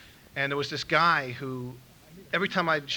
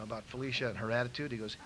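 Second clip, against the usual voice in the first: ordinary talk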